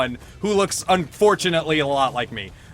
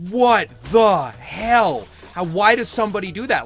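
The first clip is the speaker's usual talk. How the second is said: retold outraged disbelief; 2 semitones higher, 5 dB louder than usual. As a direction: relive the shock of the near miss. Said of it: "And I was just like".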